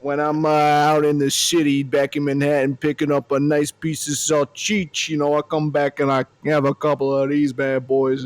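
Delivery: put-on lightly done Brooklyn tough-guy accent; a half-hearted Brooklyn wise guy bit; casual Brooklyn accent, hammed up a little. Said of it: "real issues with the accent and the mustache combo".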